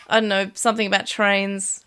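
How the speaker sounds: ordinary delivery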